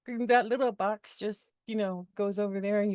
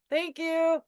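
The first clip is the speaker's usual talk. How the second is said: "Thank you" higher with a laugh; 7 semitones higher, 4 dB louder than usual. Real, not acted. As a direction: laugh it out in relief.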